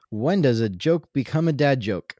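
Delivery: loud, punchy setup; joke setup read. Acted. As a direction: deliver it like a comedian. Reading joke setup aloud.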